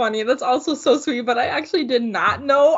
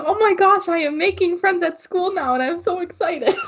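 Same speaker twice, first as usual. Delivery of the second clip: thrilled gush; 8 semitones higher than usual. Retold or imitated. Retelling lunch with a friend, a giddy, higher gush. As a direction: gush like you just made a friend. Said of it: "And I was like".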